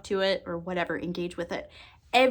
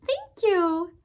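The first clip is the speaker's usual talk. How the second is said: put-on baby voice; 9 semitones higher than usual. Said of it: "in the little baby voice".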